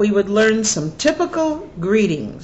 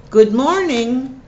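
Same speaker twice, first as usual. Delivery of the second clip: slow, higher greeting; careful demo; 5 semitones higher, 4 dB louder than usual. Shown as a demonstration. Teacher modelling the greeting for students, slow and raised. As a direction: spell it out slowly for learners.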